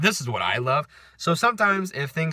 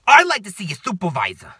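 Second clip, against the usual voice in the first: loud, raised mock New York demand; New Yorker accent; 6 semitones higher, 8 dB louder than usual. Woman demanding a supervisor, mock New York accent. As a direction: imitate an indignant New Yorker. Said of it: "like, New Yorker accent".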